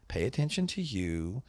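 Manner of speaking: ordinary talk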